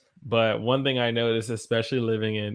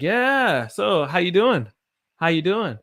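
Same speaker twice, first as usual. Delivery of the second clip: casual native greeting; 7 semitones higher, 5 dB louder than usual. Shown as a demonstration. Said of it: "I would talk like that".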